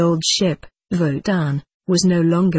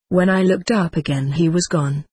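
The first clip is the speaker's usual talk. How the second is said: plain reading voice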